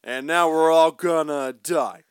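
loud cowboy voice, a hint of Wayne; mock John Wayne. John Wayne drawl joke.

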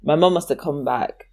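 usual speaking voice

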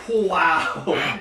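"wow" at the burn, re-enacted loud; overwhelmed by heat. Reliving spicy noodles hitting ten seconds later, overwhelmed.

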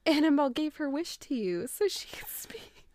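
whispered through tears; tearful hushed whisper; crying whisper. Retold or imitated.

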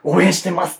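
loud, tense fan greeting; nervous fan. Retold or imitated. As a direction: blurt it out, starstruck. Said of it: "ってだけ言って".

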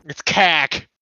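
put-on slow, drawn Boston accent on cack. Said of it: "that's like a Boston accent".

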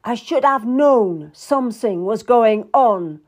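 bitter realization, firmly voiced; grim. A narrator's reading. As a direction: say it grimly to a rival. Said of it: "Anne muttered grimly".

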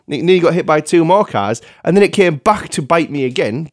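usual speaking voice

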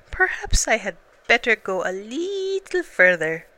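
a greedy bear making excuses to keep eating; Pooh voice. Acted.

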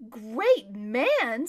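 loud, incredulous retort; scornful echo. Acted. Erebus answering praise of Hoshta, loud scornful disbelief. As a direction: throw the praise back with contempt.

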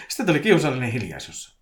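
plain reading voice

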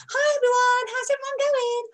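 very high, bright hello to followers; old high-pitched voice. Shown as a demonstration. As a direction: imitate her former high-pitched Essex voice. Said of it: "I had a very high-pitched voice".

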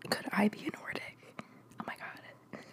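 very soft, whispered aside; stunned whisper. Real, not acted. Wondering to self if they could be a Nordic, stunned whisper. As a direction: whisper it to yourself as a sudden realization.

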